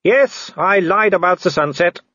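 high, called-out taunt; gloating villain. Acted. Werewolf mocking the man up the tree, high gloating call. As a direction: call back with gleeful mockery. Wolfgang calls back, gloating.

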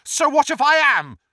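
high, loud, defiant retort; loud defiance. A narrator's reading. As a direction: throw it back loudly at the villain. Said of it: "said Neville loudly".

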